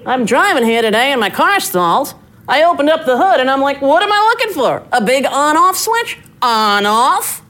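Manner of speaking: high, rising standup bit, lightly done; lightly voiced Seinfeld routine; Seinfeld joke redelivered. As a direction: pitch up into the punchline like Seinfeld. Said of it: "That was his joke".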